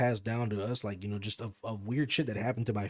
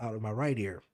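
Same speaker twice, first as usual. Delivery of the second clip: usual speaking voice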